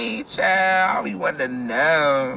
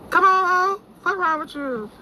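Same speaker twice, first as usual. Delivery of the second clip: much higher, playful come on; high mock urging; 11 semitones higher than usual. Telling the driver to hurry, in a much higher teasing voice.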